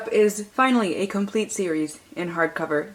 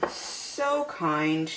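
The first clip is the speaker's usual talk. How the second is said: usual speaking voice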